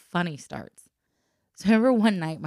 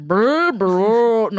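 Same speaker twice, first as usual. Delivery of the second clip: pipe rumble; 6 semitones higher, 12 dB louder than usual. Retold or imitated. Imitating pipe noise.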